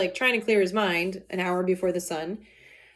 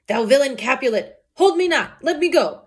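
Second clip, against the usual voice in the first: high, loud cry to be let go; angry struggle; 4 semitones higher, 6 dB louder than usual. Acted. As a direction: fight to break free of her grip. Montague straining against his wife.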